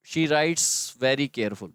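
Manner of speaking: the wrong sentence read slowly, "careful" said plainly; slow wrong take. Shown as a demonstration. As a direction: read the error out slowly for students. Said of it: "error है".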